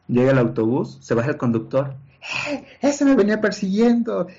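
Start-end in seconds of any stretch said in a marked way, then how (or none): 2.5-4.3 indignant accusation. Retold or imitated. Driver accusing him to police.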